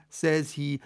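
ordinary delivery, nothing marked

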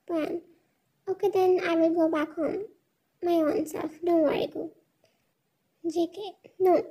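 ordinary delivery, nothing marked

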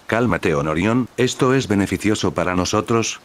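ordinary delivery, nothing marked

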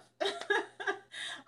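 bright high giggle; laugh. Real, not acted. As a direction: laugh warmly into the mic. Laughs while sharing love.

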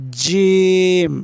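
জিম stretched very slowly, jim said like a voiced j; জিম, টেনে টেনে; drawn-out jim. Shown as a demonstration. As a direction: hold জিম long with a voiced j. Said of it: "জিম হবে বর্গীয় জর মতো".

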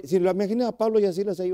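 ordinary talk

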